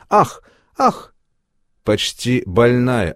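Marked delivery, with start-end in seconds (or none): 0.1-1.1 two short high-pitched yelps; little gasped "ah" of pain, twice; weak cry. A narrator's reading. Tanechka slapped twice by mistress, weak high cries. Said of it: "Танечкин слабый вскрик".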